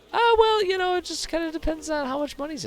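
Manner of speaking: high, put-on shifty voice; mock sly mercenary. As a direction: play the greedy sellsword.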